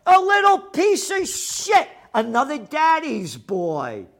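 loud, high, furious tirade; scornful shouting rant; angry rant. Real, not acted. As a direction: yell insults with contempt. Ranting insults at politicians.

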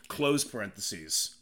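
ordinary talk

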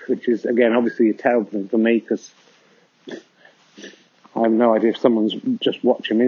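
usual speaking voice

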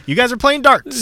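high-pitched parody of scene setting; mock game master. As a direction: ham up the cliché tavern intro. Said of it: "it's not gonna be like".

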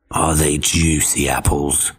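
low, slow growl, faking friendly; growly wolf voice. Acted. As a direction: growl up sweetly. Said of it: "the wolf growled up to him".

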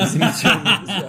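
laughing. Real, not acted. Joking about being jobless.

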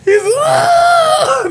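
high mock yell through laughter; laughing half-scream of a scared man; retold scream. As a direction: imitate him wailing in the room.

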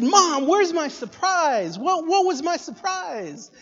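high, loud, drawn-out pestering; eager, demanding kid. Retold or imitated. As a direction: imitate a child calling to mom. Child demanding the promised surprise.

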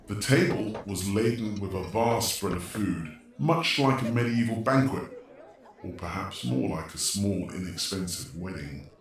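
ordinary talk, nothing marked

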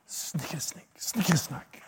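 spooky whisper of the verse; hushed Jabberwocky whisper. Shown as a demonstration. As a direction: breathe it out with no voice. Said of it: "I could never say it without laughing".

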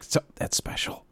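whispered, slow; hushed whisper. Retold or imitated. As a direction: whisper so nobody hears. Hushed in silent tent.

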